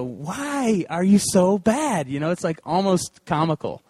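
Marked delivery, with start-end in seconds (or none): 0.0-2.1 slow, exasperated whine at himself; drawn-out mock despair, slowed way down; comic self-scolding. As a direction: moan at his own bad shot. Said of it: "I just, just go".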